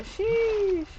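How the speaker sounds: high, slow stretched "sheesh"; drawn-out sheesh. Shown as a demonstration. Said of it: "the equivalent of".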